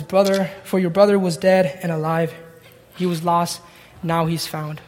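a father softly reassuring his son; soft and tender. Acted. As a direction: let the words settle gently. Father's closing words, repeated.

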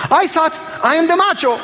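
high-pitched imitated weeping voice; crying. Big man confessing to the preacher, mock tearful crying.

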